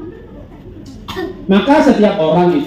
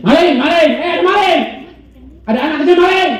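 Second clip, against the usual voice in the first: security guard yelling; 11 semitones higher than usual. Retold or imitated. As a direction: call out the thief across the mall. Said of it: "ada yang teriak nih satpamnya".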